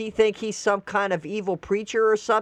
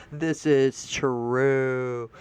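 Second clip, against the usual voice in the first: lower, very slow This is true; slow agreement; 6 semitones lower, much slower than usual. Real, not acted. Agreeing with the quoted post, very slowly. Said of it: "slow drawn agreement".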